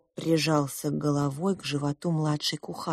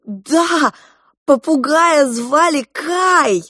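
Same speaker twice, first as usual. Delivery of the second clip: high, loud, drawn-out exclamation; excited guess; 9 semitones higher, 8 dB louder, much slower than usual. A narrator's reading. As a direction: cry out the remembered name. Клоп радостно угадывает имя. (радостный возглас)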